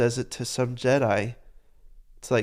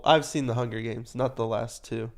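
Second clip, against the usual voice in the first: ordinary talk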